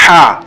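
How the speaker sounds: ہا as a plain light h, the wrong way; wrong pronunciation. Shown as a demonstration. Quran teacher showing the wrong ہا, plain h. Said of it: "ہم اس کو ہا نہیں نکالتے".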